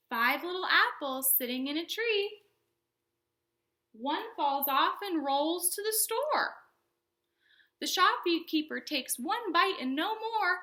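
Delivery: put-on slow, sing-song rhyme for little kids; children's librarian reciting a counting rhyme, slow and bouncy; storytime rhyme. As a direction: read it like a picture book, slowly.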